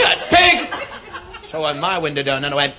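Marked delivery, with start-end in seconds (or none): 0.3-0.7 high, sharp shouted warning; urgent yell. Acted. Lady driver yelling from her car window, high urgent shout. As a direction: yell it out in short, high bursts.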